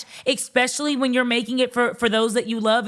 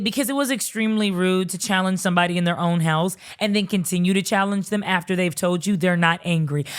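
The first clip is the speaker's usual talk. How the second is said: normal talking voice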